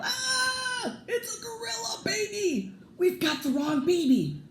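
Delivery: shrieked very high, panicked; yelling in shock. Acted. Parents grabbed by the gorilla baby, high panicked shriek. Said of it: "they both yelled".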